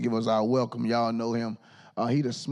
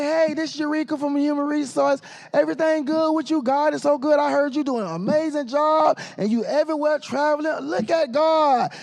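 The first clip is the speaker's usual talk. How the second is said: much higher, syrupy-polite church-lady phone voice, slowing on the last words; sugary sweet voice; 12 semitones higher than usual. Retold or imitated. Emcee mimics HR caller sweet-talking him, much higher. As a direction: ham up the overly nice caller who wants a favor. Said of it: "I ain't never heard you talk that nice".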